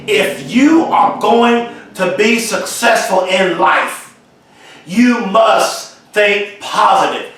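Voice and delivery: slow, deliberate, each word weighed; slow it right down so every word lands; said slowly. Shown as a demonstration. Speaker to viewers, slowing down on the key line, deliberate. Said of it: "I'm gonna say this slowly".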